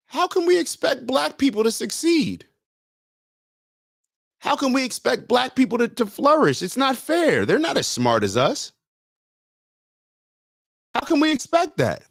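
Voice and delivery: high mocking falsetto of a condescending liberal; mock pandering voice. Vlogger mocking panderers talking down, high mock voice. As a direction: sneer it out way up high in pretend pity. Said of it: "They just pander us. They say".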